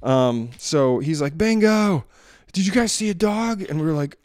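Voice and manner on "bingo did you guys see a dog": raised, high call, then a quick question; calling the dog. Retold or imitated. Owner calling, then asking neighbours.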